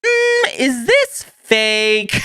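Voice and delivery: high, drawn-out doubtful hum; slow suspicious mm before the question; skeptical. Retold or imitated. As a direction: squint at it like it's a scam. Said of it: "I'm just like".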